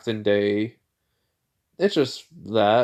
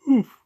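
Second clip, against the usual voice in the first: high, loud exhale of fatigue; tired oof; 6 dB louder than usual. Real, not acted. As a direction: blow out a weary oof. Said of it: "Wait, not even f-, ten, only ten minutes".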